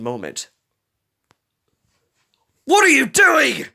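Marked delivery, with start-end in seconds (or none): 2.6-3.7 high, loud, slow angry demand; scowling shout. A narrator's reading. Prowler confronting his brother Rebel, slow loud angry shout. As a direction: snap it at the intruder. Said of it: "Prowler scowled at him".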